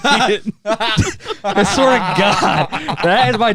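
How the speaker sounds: laughing through the words. Real, not acted. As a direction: crack up while saying it.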